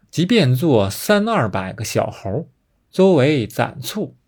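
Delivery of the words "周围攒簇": ordinary delivery, nothing marked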